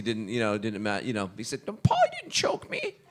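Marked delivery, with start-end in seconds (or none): 1.7-3.0 high-pitched mock denial; mock protest. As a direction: imitate a friend protesting in a squeaky high voice. Mock voicing of Joe's denial.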